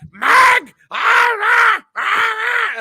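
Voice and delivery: mock the co-host's squeaky screaming at full lungs; Titan Drago scream. Host mocking absent co-host's yelling, squeaky high scream. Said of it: "that squeaky Oompa Loompa screaming at the top of his lungs".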